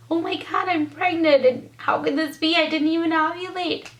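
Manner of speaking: high, overcome with happy tears; reliving the shock of joy, pitch up; emotional retelling of finding out she's pregnant. Retold or imitated. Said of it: "so happy and so emotional".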